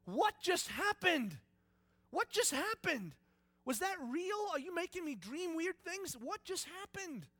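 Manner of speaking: high, loud, bewildered questioning; acted stunned outburst; shocked disbelief. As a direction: demand to know what just happened. Acts out shocked, confused Moses.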